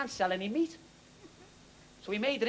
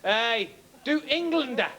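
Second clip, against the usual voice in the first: the German complains, slow and laughing; mock German accent; 4 semitones higher, slower than usual. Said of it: "the German comes back and he goes".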